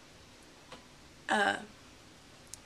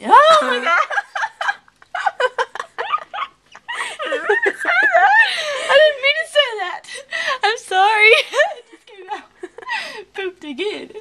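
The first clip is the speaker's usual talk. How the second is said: high, loud laughing apology; embarrassed laughter; 14 semitones higher, 10 dB louder, slower than usual. Real, not acted. Girl laughing after letting a swear slip, loud high laughter.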